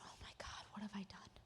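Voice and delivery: hushed, unvoiced dismay; horrified whisper. Peter whispering his guilt to himself after the rooster crows. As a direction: whisper it like a sinking realization.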